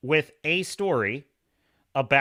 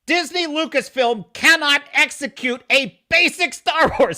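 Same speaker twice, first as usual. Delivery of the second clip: louder, higher, indignant rant; heated rant; 9 semitones higher, 4 dB louder than usual. Real, not acted. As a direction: tear into the studio, fired up. Ranting against Disney Lucasfilm.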